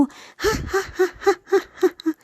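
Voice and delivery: high mocking cackle; gleeful villain cackle; wicked cackle. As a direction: cackle over the trap. Buffalo's wicked laugh.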